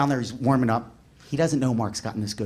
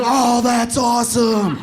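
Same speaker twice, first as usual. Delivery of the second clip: high, loud, slow awe; kids cheering; 9 semitones higher, 5 dB louder, slower than usual. Retold or imitated. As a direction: cheer in amazement.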